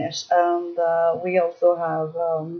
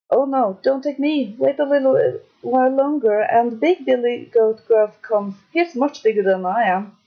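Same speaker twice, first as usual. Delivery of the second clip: pleading goat; 2 semitones higher than usual. Acted. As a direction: beg him to wait.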